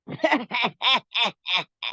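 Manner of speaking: loud, repeated staccato cackle; put-on bully's cackle; teasing laughter. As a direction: laugh mockingly while sidling up. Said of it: "Dia ketawa-ketawa deketin Aulia".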